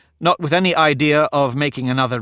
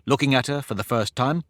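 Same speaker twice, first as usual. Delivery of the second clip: usual speaking voice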